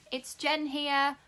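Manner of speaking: Jen said slowly and firmly; stress on Jen. Shown as a demonstration. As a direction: lean on "Jen" to set the name straight. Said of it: "not Jenny".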